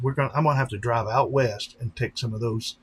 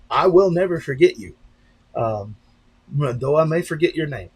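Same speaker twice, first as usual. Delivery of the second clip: louder, stressed WILL, falling firmly; emphatic promise; 3 semitones higher, 7 dB louder than usual. Real, not acted. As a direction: declare it with full conviction. Promise to forgotten fan.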